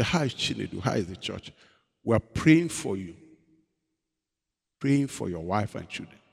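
low, soft caring greeting; caring friends. Retold or imitated. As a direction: tenderly ask after him. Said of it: "they'll say".

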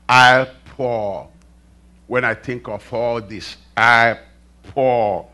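slow, low, deliberate reading; measured scripture reading. Acted. Preacher reading Ephesians to the congregation, slow and deliberate. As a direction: read it out word by word, low and unhurried.

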